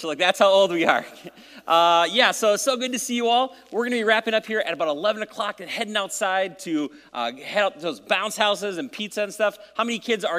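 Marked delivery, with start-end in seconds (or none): none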